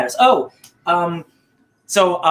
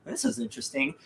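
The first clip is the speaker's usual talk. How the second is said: deadpan remark; 7 semitones higher, 13 dB quieter than usual. Retold or imitated. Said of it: "Phoenix, is just like".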